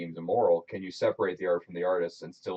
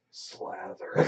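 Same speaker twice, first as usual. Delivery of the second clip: airy breathy whisper of the word; 3 dB quieter than usual. Shown as a demonstration. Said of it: "but in a breathy tone".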